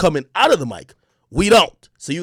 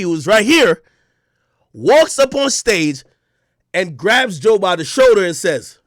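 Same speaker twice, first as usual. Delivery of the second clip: ordinary talk, nothing marked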